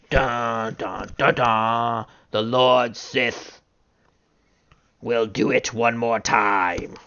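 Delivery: a dark, slow villain voice, menacing and mock-serious; Bill Belichick. Imitating the coach as a Sith lord, slow ominous low voice. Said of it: "That's my Bill Belichick imitation".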